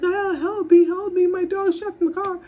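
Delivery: mock frantic lady's cry, high and loud; panicked lady. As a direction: plead for help in a hammed-up panic.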